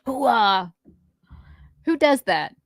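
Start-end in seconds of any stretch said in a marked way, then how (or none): 0.1-0.7 gung-ho Pacino shout; Al Pacino hoo-ah. Acted. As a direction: bark it like Pacino. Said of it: "Try to guess who I am".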